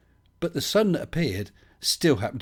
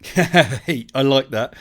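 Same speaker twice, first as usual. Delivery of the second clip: excited; 4 semitones higher, 10 dB louder, much slower than usual. Real, not acted. Sudden delight at chat comment.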